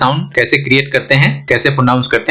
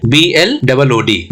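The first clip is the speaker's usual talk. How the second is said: ordinary talk, nothing marked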